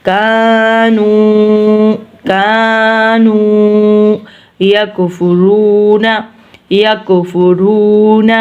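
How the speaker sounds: very slow, level, long vowels in "بآیات اللہ"; elongated recitation. Shown as a demonstration. Teacher showing lengthened letters, elongated recitation. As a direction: sustain both vowels of "کانو" like chant. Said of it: "ان تینوں حروف کو لمبا کیا ہے".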